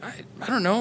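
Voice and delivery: whispered, caught off guard. Retold or imitated. Tutor whispering back to the girl, unsure. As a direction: breathe it out unvoiced, unsure.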